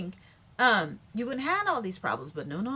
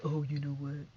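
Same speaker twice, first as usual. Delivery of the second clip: mocking timid people; 5 semitones lower than usual. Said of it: "Some of 'em like".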